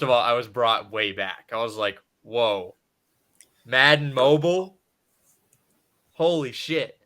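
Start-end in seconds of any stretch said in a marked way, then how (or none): 2.2-7.0 surprised. Retold or imitated. Amazed at hearing Madden Mobile.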